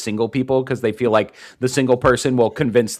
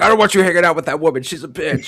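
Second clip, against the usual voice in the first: fast, higher-pitched acted grumble; mock scolding; 6 semitones higher, faster than usual. Movie husband objecting to her friend, mock scolding. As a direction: rush out a hammed-up complaint. Acting out film character's scolding.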